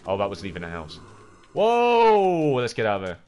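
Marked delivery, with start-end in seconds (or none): 1.5-2.5 high, loud whoa; startled cry. Real, not acted. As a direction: yelp it out in alarm. Startled during the game.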